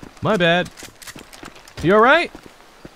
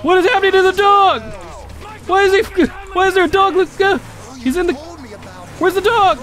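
high, loud, baffled; suddenly alarmed, pitch jumps up; what is happening, high and startled; alarmed surprise; 10 semitones higher, 3 dB louder than usual. Real, not acted. Dog suddenly appears.

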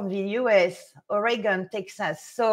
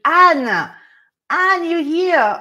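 excited call; 7 semitones higher, 9 dB louder, much slower than usual. Real, not acted. Calling out to a viewer.

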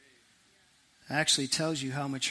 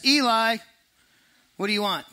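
put-on loud child's call into the dark, higher; calling out to Eli; 6 semitones higher, 6 dB louder than usual. Young Samuel calls to Eli in the night, loud and high. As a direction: call out across the room to wake him.

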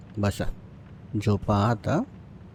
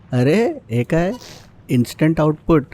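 surprised; 5 semitones higher, 3 dB louder than usual. Real, not acted. Baby suddenly soils nappy.

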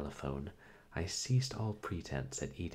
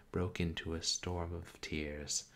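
ordinary delivery, nothing marked

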